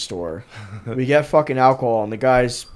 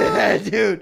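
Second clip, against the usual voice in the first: laughing; 5 semitones higher than usual. Real, not acted. Laughing confirmation.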